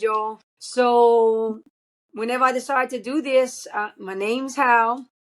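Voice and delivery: usual speaking voice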